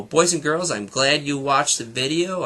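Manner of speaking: ordinary delivery, nothing marked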